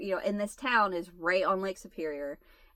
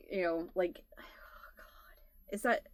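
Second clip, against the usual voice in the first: whispered aside; 2 semitones lower, 8 dB quieter than usual. Real, not acted.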